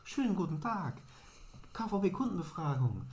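mock the chirpy survey pitch; cheery pollster at the door; survey caller voice. Man addressing passengers, survey pitch.

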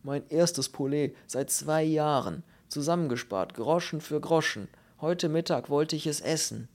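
plaintive, wounded little man; groaning lament. Acted. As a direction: lament every penny saved for nothing. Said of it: "Der Glatzkopf stöhnte".

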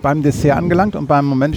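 normal talking voice

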